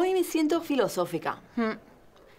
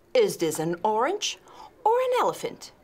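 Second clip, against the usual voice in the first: ordinary talk, nothing marked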